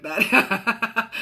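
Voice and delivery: amused, laughing at the end. Real, not acted. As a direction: break into laughter at your own impression. Laughs at own mom voice.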